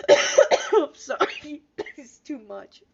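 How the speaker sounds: slow, loud, high through laughter; laughing apology. Real, not acted. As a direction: apologize while still cracking up.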